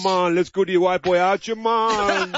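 put-on Jamaican lilt; Jamaican accent. Host mimicking [name] for laughs, mock Jamaican accent.

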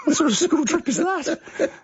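high-pitched, laughing through the question; amused disbelief. Real, not acted. Host reacts to a school trip lasting two years, laughing incredulously.

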